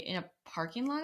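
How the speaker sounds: usual speaking voice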